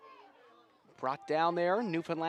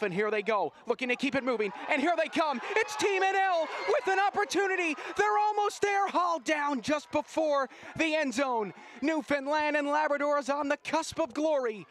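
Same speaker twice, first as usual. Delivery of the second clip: much higher and louder, building to a yell; frantic climactic call; 11 semitones higher, 4 dB louder than usual. Acted. Commentator calling the last-second winning try, building to a shout. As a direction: scream the winning try home.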